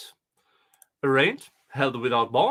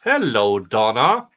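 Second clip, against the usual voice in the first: mocking hello; 3 semitones lower, 9 dB louder than usual. Real, not acted. Loud mocking greeting.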